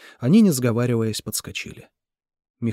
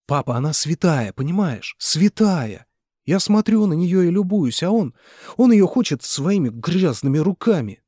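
louder and higher, fervent and indignant; passionate outburst; 7 semitones higher, 6 dB louder than usual. Acted. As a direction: defend her purity with rising heat. Mitya defends her fervently. (Митя горячо защищает её перед отцом)

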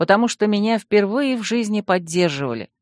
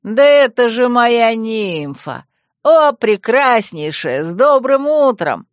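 loud, slow, higher joyful shout; 4 semitones higher, 5 dB louder, slower than usual. Acted. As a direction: shout a cheery greeting across the yard. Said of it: "а Вемут продолжал кричать". (радостный крик)

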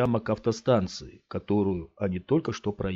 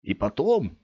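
coaxing stranger; 11 semitones higher than usual. A narrator's reading. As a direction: lure the boy to stay. Big man coaxing Scott.

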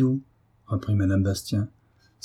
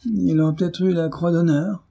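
high-pitched, sneering suggestion; snide false admiration; sly mockery; 6 semitones higher than usual. A narrator's reading. Bailiff sniping about the rescuers' medal, sly mockery. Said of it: "d'un air narquois et sournois".

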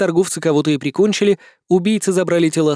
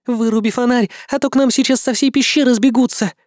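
urgent female voice; 7 semitones higher than usual. Acted. Demoness scolds narrator.

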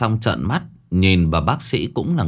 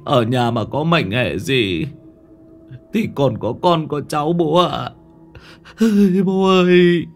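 tearful pleading, voice climbing as he begs; sobbing plea; 5 semitones higher than usual. Acted. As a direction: son weeping at his father's bedside. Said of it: "tiếng khóc thút thít".

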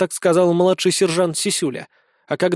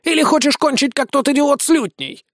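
high-pitched threatening creature; indignant snail; 8 semitones higher than usual. A narrator's reading. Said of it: "возмутилась улитка". (возмущённая улитка с угрозой)

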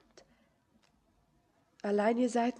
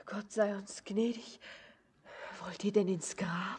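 hushed, voiceless prayer of fright; frightened whisper. Acted. Girl horrified by the stranger's talk of dying, whispering. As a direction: breathe it out in dread.

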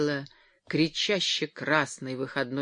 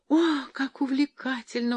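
high-pitched thrilled cry; delighted exclamation; 10 semitones higher than usual. A narrator's reading. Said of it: "воскликнула Джоан".

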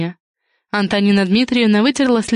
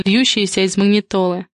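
usual speaking voice

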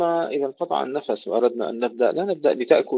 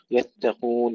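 ordinary talk, nothing marked

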